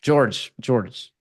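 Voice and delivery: ordinary talk, nothing marked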